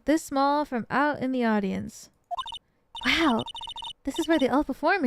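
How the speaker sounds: ordinary talk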